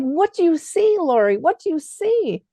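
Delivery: put-on high, pressing old woman's voice; an older lady demanding an answer; voicing Kay. Kay pressing Lori about the photo, high and pleading.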